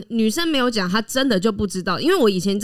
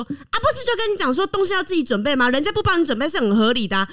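mom scolding me; 6 semitones higher than usual. Retold or imitated. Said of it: "我妈妈就会说，".

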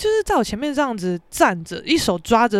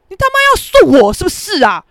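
loud, raised-pitch accusation; angry yell; 6 semitones higher, 7 dB louder than usual. Retold or imitated. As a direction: yell it as a challenge. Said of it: "我这样大叫啊". (大叫着质问)

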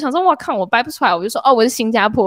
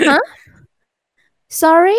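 fake confused sorry; 7 semitones higher, 6 dB louder than usual. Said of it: "然后我就说".

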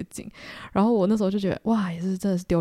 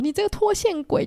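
much higher mock scolding; scolding parent; 13 semitones higher than usual. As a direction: imitate parents nagging their scatterbrained kid. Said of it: "我的爸妈可能会想说". (模仿爸妈的尖声责骂)

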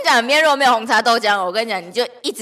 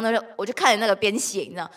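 usual speaking voice

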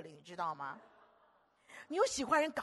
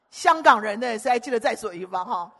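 much louder call to the crowd; 13 dB louder than usual. Real, not acted. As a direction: call out to the Hong Kong folks. Calling out to congregation. (大声问会众)

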